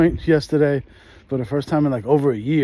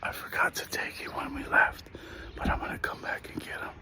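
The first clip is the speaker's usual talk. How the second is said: hushed whisper, softer; 4 semitones lower, 11 dB quieter than usual. Real, not acted. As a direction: whisper it so nobody hears. Sneaking by old house.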